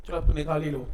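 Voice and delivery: usual speaking voice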